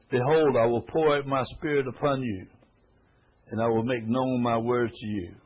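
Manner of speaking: loud, solemn proclaiming; scripture reading. Acted. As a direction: announce the promise to the whole room. Reads scripture aloud.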